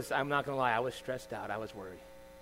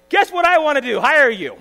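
loud, high mock announcement; imagined eager employer; 8 semitones higher, 10 dB louder than usual. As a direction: burst in with a big job offer.

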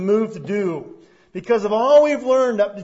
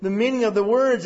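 usual speaking voice

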